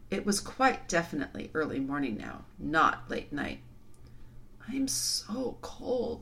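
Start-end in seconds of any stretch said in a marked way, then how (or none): 4.7-6.2 breathy, drawn-out half-whisper; high, whispered chill; shivery cold. A narrator's reading. Cold, worn-out girl speaks.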